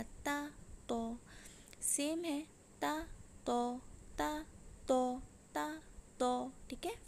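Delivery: ता and तो alternated slowly, thin ता, heavy ओ in तो; drill demo. Shown as a demonstration. Teacher leading students through a slow ता तो drill. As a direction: chant ता तो ता तो as a careful drill. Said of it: "इसकी मशक करेंगे".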